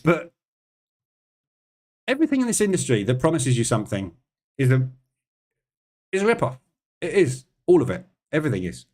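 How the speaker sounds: heated rant, voice jumping up; angry rant. Real, not acted. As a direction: vent hard about the industry being a rip-off. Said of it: "sorry about the rant".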